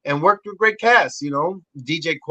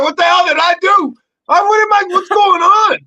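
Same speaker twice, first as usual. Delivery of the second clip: much higher, louder bewildered outburst; retold freak-out; 13 semitones higher, 6 dB louder than usual. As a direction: shout it high in exaggerated disbelief. Panicked reaction to request flood.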